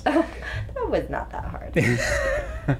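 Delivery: giggling through the words; laughing. Real, not acted. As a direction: laugh while you say it. Laughing at own joke.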